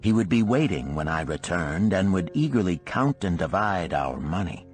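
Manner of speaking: plain reading voice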